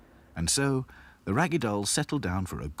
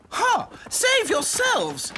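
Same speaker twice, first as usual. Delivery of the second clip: loud, very high, drawn-out retort; scornful princess doll; 5 dB louder, much slower than usual. A narrator's reading. Princess dolls abandoning Lucy, scornful. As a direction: throw it back with high scorn.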